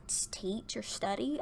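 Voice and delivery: soft unvoiced whisper. Real, not acted. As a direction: drop to a whisper on the aside. Whispered aside about school.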